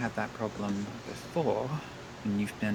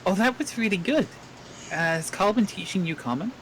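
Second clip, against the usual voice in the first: higher, louder called-out praise; calling out; 8 semitones higher, 5 dB louder than usual. Acted. Dan calls out to the goblin guard, loud and high. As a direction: call across to the guard, cheerful. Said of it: "call out in Goblin to Bork".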